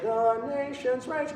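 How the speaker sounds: limp, indifferent mock singing; unexciting choir imitation. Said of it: "It sounds like".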